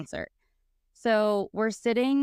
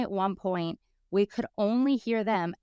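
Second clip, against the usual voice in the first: usual speaking voice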